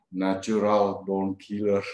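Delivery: slow, weighted film title, lightly voiced coworker; slow ominous title. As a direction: let the title hang, slow and heavy. Coworker names violent film.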